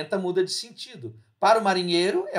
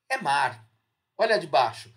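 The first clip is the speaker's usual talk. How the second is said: ordinary talk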